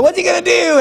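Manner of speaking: high-pitched, hammed-up panic; mock freak-out. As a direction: cry out like alarmed onlookers. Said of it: "they're freaking out".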